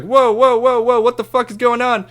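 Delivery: high, loud whoa whoa to stop the crowd; alarmed shout. Retold or imitated. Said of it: "I'm just like".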